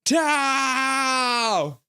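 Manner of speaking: high mock shout of the name; hammed-up yell from chat; imitating a shout. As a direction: call out like a chatter yelling across. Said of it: "Calvin nine hundred was like".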